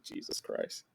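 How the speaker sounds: breathless whispered Jesus Christ; whispered aside. Retold or imitated. To himself about the cackling lady, a hushed whisper. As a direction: whisper it under your breath, exasperated. Muttered reaction to loud neighbor.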